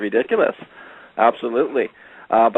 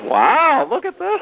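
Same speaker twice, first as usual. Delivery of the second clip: high, loud excited wow; retold burst of delighted astonishment; lit-up amazement; 5 dB louder, much slower than usual. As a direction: imitate a man lighting up at what he sees. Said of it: "he really lit up".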